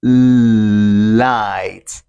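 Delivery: 'light' with a long, drawn-out L at the start; light L. Shown as a demonstration. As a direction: hold the L of 'light' before releasing it. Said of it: "Like this".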